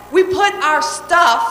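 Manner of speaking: usual speaking voice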